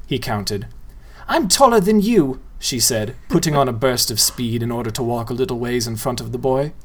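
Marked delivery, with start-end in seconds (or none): 1.3-2.4 put-on girl's voice. Girl voiced in story.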